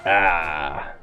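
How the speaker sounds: normal talking voice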